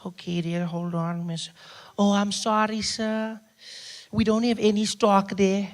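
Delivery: politely apologetic pharmacist voice. Retold or imitated. Pharmacist tells a customer there is no stock, polite role voice. Voicing pharmacist in retold exchange.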